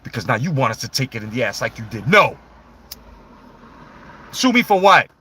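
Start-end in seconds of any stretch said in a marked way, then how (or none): 2.1-5.1 loud defiant No; defiant shout. Pretend. Voicing Stone Cold to the fan, a loud defiant shout. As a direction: bark it back in defiance.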